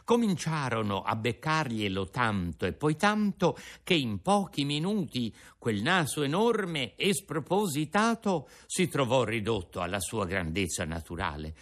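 usual speaking voice

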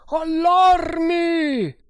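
very high, long-drawn yell; shouted alarm. A narrator's reading. Another voice raises the alarm, shouting. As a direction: sound the alarm, stretching the word out. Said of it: "gridava un'altra".

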